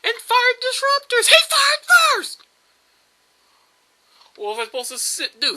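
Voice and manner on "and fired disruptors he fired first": high, very loud, drawn-out yell; indignant shout. Pretend. Gamer protesting an enemy's attack, high indignant shout. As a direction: shout the accusation at the top of your voice.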